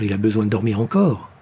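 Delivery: ordinary delivery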